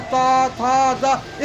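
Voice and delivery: त, थ, द each said loud and separate; त, थ, द recited like a childhood alphabet drill; syllable drill. Shown as a demonstration. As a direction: sound out त, थ, द one by one, clearly. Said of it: "इस तरह का उच्चारण करें".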